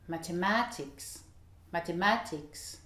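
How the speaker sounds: "Mathematics" with the stress on MA; English stress on MA. Shown as a demonstration. Teacher models English "mathematics" for learners, stress on MA. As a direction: hit the "ma" in "mathematics". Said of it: "ça va être sur le ma encore".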